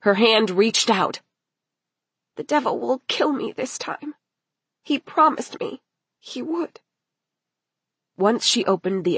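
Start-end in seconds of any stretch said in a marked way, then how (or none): 2.3-6.8 high, hushed dread; fearful thought in a small high voice; frightened girl. Acted. Girl's fearful thought of the devil.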